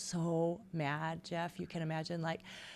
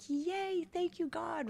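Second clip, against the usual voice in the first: giddy newlywed thanks; 11 semitones higher, 3 dB quieter, slower than usual. Retold or imitated. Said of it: "it was like".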